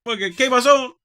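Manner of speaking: put-on high, loud Chicano accent voice. Voicing the dog in Spanish.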